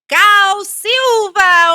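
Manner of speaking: slow, loud, high name reveal; grand entrance announcement. Acted. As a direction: stretch the name out like a ringmaster. Name shouted as climax.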